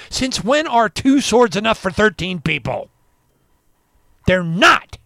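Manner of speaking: loud, scornful challenge; indignant outburst. Real, not acted. Scolding pastors over the scripture, loud indignant. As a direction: slam the question home.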